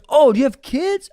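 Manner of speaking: lightly put-on, lilting inquiry; imitated woman's question. Voicing the woman's small talk.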